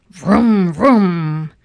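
revving car noise, loud and drawn out; big playful engine roar; loud engine vroom. A narrator's reading. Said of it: "Mister Watson said".

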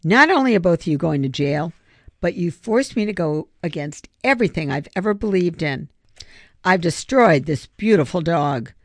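plain reading voice

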